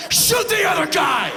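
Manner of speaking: high, loud hammed-up yell; urgent shouted order. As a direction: scream the instruction to police. Acted urgent order to police.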